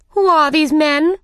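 high, loud queenly demand; imperious Queen. A narrator's reading. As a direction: demand to know, as a bossy Queen.

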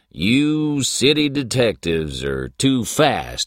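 a chuckling country sheriff; old sheriff voice. A narrator's reading. Patton laughing.

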